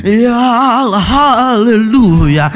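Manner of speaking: drawn-out, wavering mock hallelujah; quavering prayer imitation. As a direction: imitate a fervent pastor, stretching and trembling each word. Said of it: "terus nadanya bergetar-getar gitu loh".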